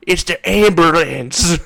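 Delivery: loud, high, drawn-out exclamation; hammed-up dying scream; horrified last cry. Villain's horrified last words.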